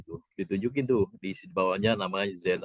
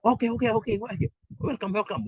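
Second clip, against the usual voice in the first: much higher, quick welcoming; eager welcome; 13 semitones higher than usual. Retold or imitated. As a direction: wave them in eagerly. Guard lets them through. (menyambut dengan semangat, suara sangat tinggi)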